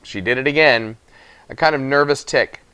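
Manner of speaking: ordinary delivery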